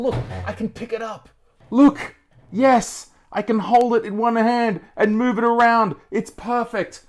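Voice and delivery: high, loud and thrilled; giddy delight at how light it is; excited showing off. Real, not acted. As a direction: lift it up proudly, almost squealing.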